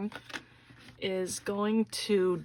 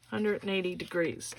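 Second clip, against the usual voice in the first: usual speaking voice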